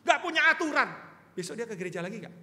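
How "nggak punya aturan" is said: loud high scolding rant; harsh scolding. Shown as a demonstration. Pastor shows how not to rebuke youth, harsh loud scolding. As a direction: scold the young man harshly, much louder and higher. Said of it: "kalau ngomong begini".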